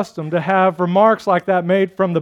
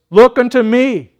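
loud, raised pulpit call; quoted preacher; 5 semitones higher, 7 dB louder than usual. Retold or imitated. Lay preacher reading his text to the chapel, called out loudly. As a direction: call the text out high and strong. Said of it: "The text was".

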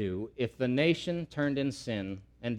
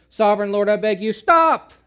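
desperate plea; 8 semitones higher, 12 dB louder than usual. Acted. Amos begging God to stop the fire, loud desperate plea. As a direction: beg God to stop with all you have. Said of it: "and he says".

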